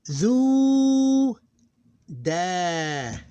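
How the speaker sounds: "zu" sung out high and loud, held long, then "da"; teacher showing a long vowel in a syllable; drawn-out Arabic syllables. Shown as a demonstration. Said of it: "Here we have".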